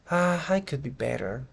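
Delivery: sighing, resigned answer; slightly pessimistic. Shown as a demonstration. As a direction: open on a weary "Ah" and let it droop. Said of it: "una entonación un poco pesimista".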